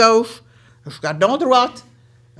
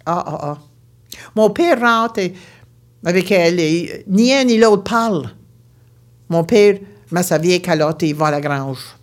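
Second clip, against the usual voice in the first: ordinary delivery, nothing marked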